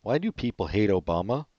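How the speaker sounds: ordinary talk, nothing marked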